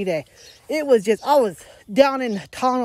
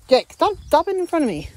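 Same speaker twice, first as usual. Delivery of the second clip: scolding the dog; 8 semitones higher, 4 dB louder than usual. Real, not acted.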